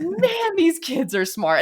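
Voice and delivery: high amazed "man", slow. Retold or imitated. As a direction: marvel at the kids. Amazed reaction to niece.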